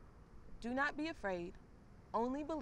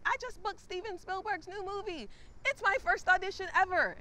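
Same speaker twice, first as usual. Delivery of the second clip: much higher, bubbly bragging voice; high, louder mock brag of a first-timer; gushing lucky actor; 10 semitones higher, 5 dB louder than usual. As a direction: play the giddy newcomer who got it all. Mocking the lucky actor friend.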